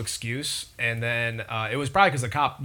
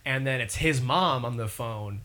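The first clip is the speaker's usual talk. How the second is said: normal talking voice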